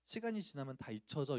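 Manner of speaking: soft, soothing reassurance; imitating a mom's tender comfort; comforting mother. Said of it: "어머니가 저를 위로해 주시면서".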